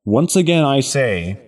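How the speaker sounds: loud, rallying battle speech; rally the crowd like a paladin; rousing speech. Acted.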